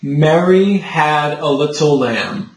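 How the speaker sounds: slow, carefully shaped tall vowels on Mary and lamb; measured model sentence, open vowels; full singer's vowels. Shown as a demonstration. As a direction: speak it slowly with rounded-out choir vowels. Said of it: "try speaking it to me".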